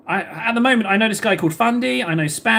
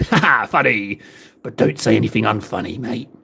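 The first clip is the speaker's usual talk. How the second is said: imitating Fundy; 8 semitones lower than usual. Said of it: "He's like".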